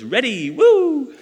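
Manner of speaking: put-on high, slow squeal of a little girl; playful mock baby cheer; excited baby daughter. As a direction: imitate a thrilled toddler cheering. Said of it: "She goes".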